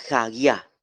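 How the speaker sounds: hagia with the second A stressed, ha-GIA. Shown as a demonstration. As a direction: lean on the second vowel A in hagia. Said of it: "La acentuación en la segunda vocal A".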